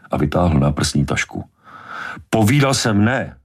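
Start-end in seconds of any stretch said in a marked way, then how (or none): 2.3-3.4 sharp, higher-pitched bark of refusal. A narrator's reading. Brody arguing over the cage, barking. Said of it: "vyštěkl Brody".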